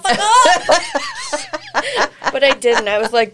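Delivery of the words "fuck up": high, loud, drawn-out taunt; mock-yell a victory put-down; hammed-up shouted comeback; imagined trash talk. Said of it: "I said all that other stuff on the inside".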